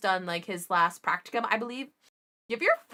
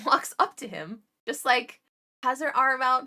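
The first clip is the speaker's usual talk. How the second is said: ordinary talk, nothing marked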